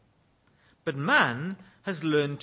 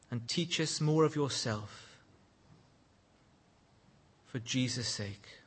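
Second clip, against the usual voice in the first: prayer; 4 semitones lower than usual. Real, not acted. Pastor praying for the congregation, hushed and reverent.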